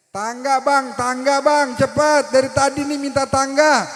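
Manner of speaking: demanding the ladder from below; urgent plea. Retold or imitated. Orang Sunda minta tangga, mendesak. (teriakan mendesak minta tangga)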